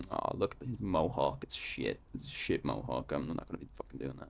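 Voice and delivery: low, quiet scornful mutter; low muttered scoff. Retold or imitated. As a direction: mutter it under your breath, unimpressed. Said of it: "I'd probably be like".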